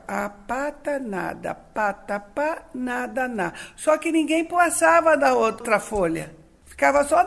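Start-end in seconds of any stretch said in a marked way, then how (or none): 0.1-3.5 slow, syllable by syllable like a beginner reader; sounding out the primer. Retold or imitated. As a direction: spell out each word like a child learning. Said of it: "Então eu ficava". (lendo a cartilha devagar, sílaba por sílaba)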